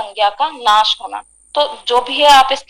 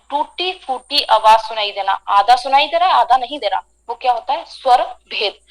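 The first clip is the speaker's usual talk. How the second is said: usual speaking voice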